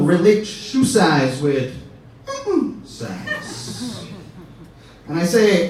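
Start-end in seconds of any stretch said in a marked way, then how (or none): 2.8-4.1 soft, coy hint after a pause; suggestive innuendo. Shown as a demonstration. Comic explaining what shoe size implies, soft and suggestive. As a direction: slip the word in softly, full of innuendo.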